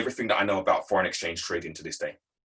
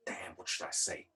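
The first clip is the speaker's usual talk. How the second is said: much softer, lower voice, thinking to himself; hushed inner thought; 3 semitones lower, 13 dB quieter than usual. Retold or imitated. Employee stalling after the boss's question, hushed inner thought. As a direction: say it privately, under the breath. His private thought during the pause.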